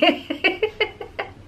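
high, airy run of laughter; real high giggling laugh; amused giggle. Real, not acted. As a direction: giggle helplessly at a friend's teasing. Laughing again at Maggie's comment.

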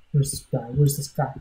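low, soft muttering; muttering search. Real, not acted. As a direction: mutter it while sneaking around. Searching for intruder in game.

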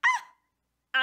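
high, loud shriek of shock; sudden splashed scream; shrill scream. Retold or imitated. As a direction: squeal as the cold water hits. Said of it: "And I'm like".

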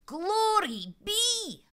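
very slow, high, drawn-out wonder; boy face to face with a leprechaun, awed; awed glory be. Acted. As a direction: let the awe stretch it out. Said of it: "Finnegan muttered at last".

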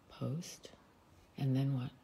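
unvoiced whisper while fiddling with the phone; whispering to herself. Real, not acted. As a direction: breathe the word to yourself. Fumbling with app on live.

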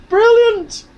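very high, loud put-on voice; mock praise. Congratulating self for not spilling it all, mock praise. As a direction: squeal sarcastic congratulations to yourself.

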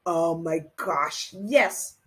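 ordinary delivery

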